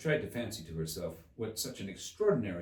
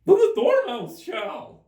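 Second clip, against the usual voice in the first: much higher, louder, slow cry; cried out together; 17 dB louder, slower than usual. Acted. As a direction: shout it as a joint demand. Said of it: "they both cried".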